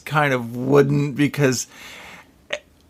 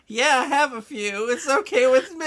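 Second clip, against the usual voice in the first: lax doctor; 10 semitones higher than usual. Retold or imitated. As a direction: breezily wave it off like a careless doctor. Mock lax doctor quote.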